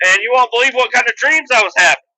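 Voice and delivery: a storyteller's big high tease; excited teaser. Real, not acted. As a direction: hype up what's coming. Teasing the dream story.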